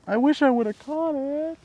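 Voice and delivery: high, loud, drawn-out wail; slow, high whine of regret; anguished lament. Real, not acted. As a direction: wail with regret over the one that got away. Laments the lost fish.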